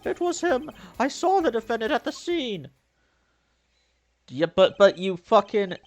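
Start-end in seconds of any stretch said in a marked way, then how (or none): 0.0-2.7 witness voice. Acted. Voices witness giving testimony.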